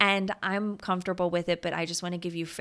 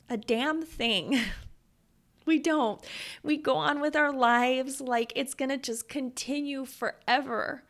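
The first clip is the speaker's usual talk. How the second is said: higher, strained voice close to sobbing; holding back tears; 5 semitones higher than usual. Real, not acted. Mom telling listeners life isn't guaranteed, voice close to crying. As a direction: push through the tears with conviction. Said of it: "I thought I was gonna just, like, start sobbing".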